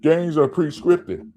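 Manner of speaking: sneering impression of fans who cry fix; mocking other people. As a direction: parrot the conspiracy crowd with scorn. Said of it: "people always say".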